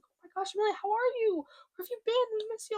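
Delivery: high-pitched imitation of gushing friends; mock excited catching-up voice. Voices friends reaching out to her.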